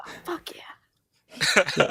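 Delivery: whispered. Acted. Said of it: "unvoiced excited whisper".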